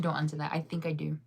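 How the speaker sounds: ordinary talk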